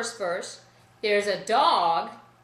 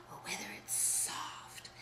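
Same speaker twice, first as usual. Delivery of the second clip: whisper; 19 dB quieter than usual. Shown as a demonstration. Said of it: "explaining dynamics".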